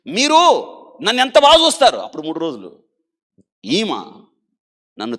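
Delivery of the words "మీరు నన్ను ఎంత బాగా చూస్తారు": high, loud tearful-sounding praise; a desperate girl telling her doctor how kind he is; emotional outcry. Retold or imitated. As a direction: cry it out to the one who cared.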